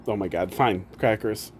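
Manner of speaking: ordinary talk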